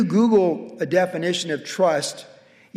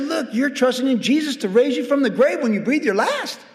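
raised, pressing voice; emphatic rebuke; 7 semitones higher than usual. Retold or imitated. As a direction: lean in and scold them with conviction. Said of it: "I said".

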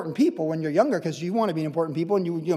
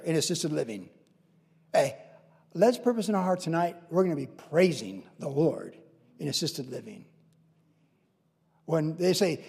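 ordinary talk

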